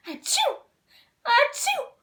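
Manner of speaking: high, loud double sneeze; big comic sneezes; sneezing. Acted. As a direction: sneeze hard, twice, rising. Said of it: "The fairies sneezed so hard".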